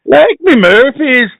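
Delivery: high, slow Irish attempt sliding into Indian; botched Irish accent. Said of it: "you did go Indian halfway through".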